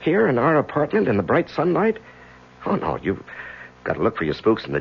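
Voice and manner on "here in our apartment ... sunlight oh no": forced cheer. Acted. Laughing off his wife's worry.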